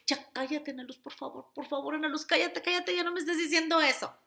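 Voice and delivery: mock frustrated inner scolding; exasperated self-talk. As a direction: beg your own mind to shut up. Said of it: "tienes esos diálogos con tu voz".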